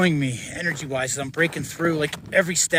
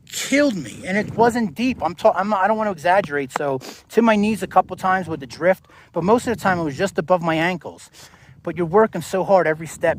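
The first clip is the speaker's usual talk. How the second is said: worked-up rant; 5 semitones higher, 7 dB louder than usual. Real, not acted. Exasperated about the exhausting snowfield.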